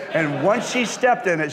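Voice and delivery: ordinary talk, nothing marked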